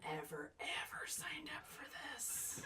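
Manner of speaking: slow, very soft whisper; whispered regret. Acted. Character realising what they signed up for, whispered regret. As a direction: whisper it slowly, like a private regret.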